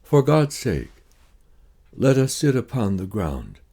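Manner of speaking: normal talking voice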